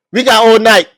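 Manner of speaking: loud, teasing crowd-hype; taunting call. Retold or imitated. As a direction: egg the rapper on loudly. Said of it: "he's like".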